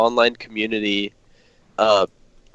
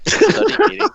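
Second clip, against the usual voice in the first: high falsetto; squeaky punchline; 4 dB louder than usual. Pretend. Punchline in silly voice.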